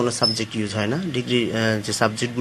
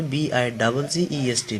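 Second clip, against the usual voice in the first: slow letter-by-letter spelling, বিআই double জি ই আর; slowly spelling বিআই double জি ই আর letter by letter; slower than usual. Shown as a demonstration. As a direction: spell each letter deliberately for the class. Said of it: "শেষের কনসোনেন্টটা আমরা double করে দেবো".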